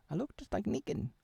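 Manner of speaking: high put-on French Negan. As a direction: keep the squeaky French villain voice. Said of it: "French version of Negan".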